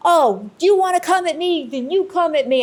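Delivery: high mock yell, not truly screamed; retold angry scream. Medium re-enacting her fight with the spirit, mock screaming. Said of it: "I start screaming like mad".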